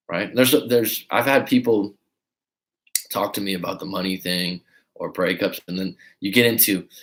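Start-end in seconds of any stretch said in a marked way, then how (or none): none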